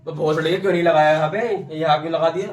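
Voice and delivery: loud, insistent questioning; pressing a study partner loudly; real exasperated challenge. Real, not acted. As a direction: raise your voice and demand an explanation. Heated challenge over the other's answer.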